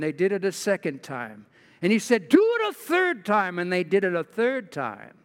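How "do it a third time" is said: high, emphatic order, read aloud; prophet's command. Acted. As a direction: raise your voice into Elijah's command. Elijah orders them, read aloud.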